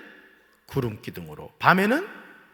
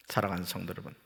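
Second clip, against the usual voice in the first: ordinary delivery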